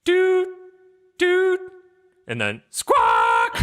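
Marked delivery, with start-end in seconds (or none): 0.1-1.6 high, loud electronic beep; countdown beep. Acted. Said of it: "L E D on the dashboard go". 2.7-3.6 loud, drawn-out bird squawk. Acted. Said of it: "when the bird squawks".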